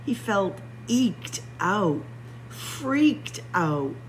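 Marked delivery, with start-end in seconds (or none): none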